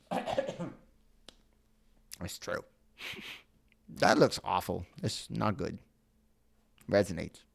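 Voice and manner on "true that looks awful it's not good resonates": usual speaking voice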